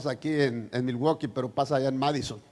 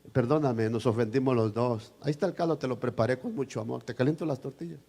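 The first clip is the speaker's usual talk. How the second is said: a hint of a softer, lower, loving wife; tender apology; 5 semitones lower, 6 dB quieter than usual. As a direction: coax him back gently. (esposa pidiendo perdón con ternura)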